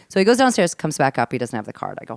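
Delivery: normal talking voice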